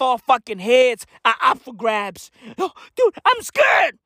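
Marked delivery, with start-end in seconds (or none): 2.6-4.0 high mock fear. High scared cry.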